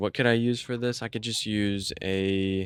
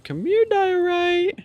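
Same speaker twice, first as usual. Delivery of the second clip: high, drawn-out squeal of a call; high-pitched mock summons; playful calling out; 4 dB louder, slower than usual. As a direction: call it over in a very high, stretched voice. Calling out to a block.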